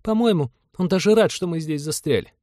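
put-on high girlish grumble; indignant young woman. A narrator's reading. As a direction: complain crossly in a high girl's voice. Girl grumbles about the old man.

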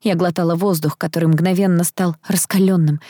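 plain reading voice

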